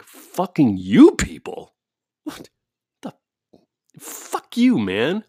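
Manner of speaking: stew over it while walking off; angry muttering. Retold or imitated. Speaker grumbling at the neighbor while walking the dog, slow angry muttering. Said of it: "walked away angrily because I'm like".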